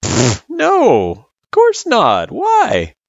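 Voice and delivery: high, amused dismissal with a scoff; light laugh. A narrator's reading. Friend dismissing the girl's worry, lightly amused. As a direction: puff it away lightly, drawn out. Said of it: "said with a laugh".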